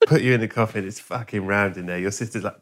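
ordinary talk

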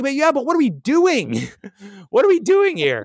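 high-pitched exasperated protest; making a scene. Retold or imitated. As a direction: retell a pleading protest, pitch jumping up. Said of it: "I'm kinda making a scene".

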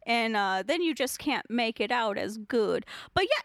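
normal talking voice

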